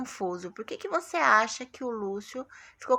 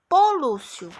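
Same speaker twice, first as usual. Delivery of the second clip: "Pô" higher and louder with a fall; teacher models the exclamation; exclaimed; 6 semitones higher, 7 dB louder, slower than usual. Shown as a demonstration. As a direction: call out "Pô, Lúcio" emphatically. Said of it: "Tá vendo que tem aqui".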